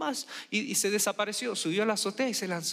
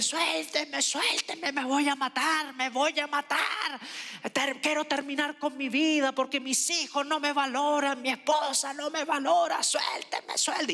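put-on desperate drunk uncle; 8 semitones higher than usual. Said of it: "Y él".

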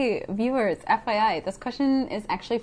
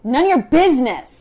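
louder, higher burst on the key word; snappy retort; 4 semitones higher, 7 dB louder than usual. Acted. Refusing to explain the ribbon, snappy loud retort. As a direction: push it out as a loud rebuff. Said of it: "And I say".

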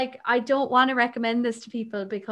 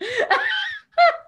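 high laugh-cry; 4 dB louder than usual. Real, not acted. Laughs after heavy book.

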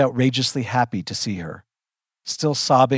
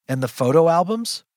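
higher, rising disbelief; incredulous question; 6 semitones higher, 3 dB louder than usual. A narrator's reading. Child repeats mother's absurd list, incredulous and rising. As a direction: query it as if it makes no sense. Baffled echo of mother's words.

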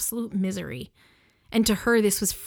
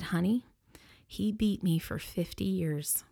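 hushed whisper; 5 dB quieter than usual. Retold or imitated. A widow quietly whispers about her husband to the funeral director. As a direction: breathe it out, barely voiced. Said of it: "she looked at me across the table and she said".

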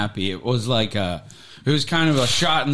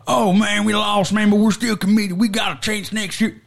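higher, sing-song mock pep talk; mock upbeat coach; 8 semitones higher than usual. Friend mocking what the coach should have said, high and sing-song. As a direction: chirp a hammed-up we're-still-here promise.

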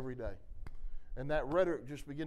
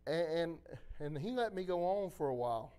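normal talking voice

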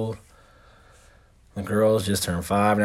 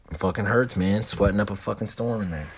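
ordinary talk, nothing marked

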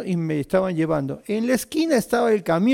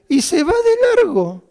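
much higher and louder, laughing through it; 11 semitones higher, 7 dB louder than usual. Real, not acted. Repeating the punchline to listeners, laughing hard. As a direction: squeeze the words out through laughter.

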